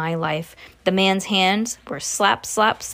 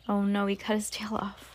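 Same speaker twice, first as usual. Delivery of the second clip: soft, dismayed whisper; whispered aside; 9 dB quieter than usual. Real, not acted. Reader's hushed aside to listeners.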